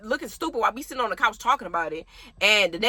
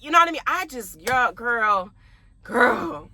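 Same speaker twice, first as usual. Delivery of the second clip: normal talking voice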